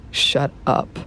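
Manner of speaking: hissed angry shut-down; sharp angry hiss. A narrator's reading. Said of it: "the other cut in angrily".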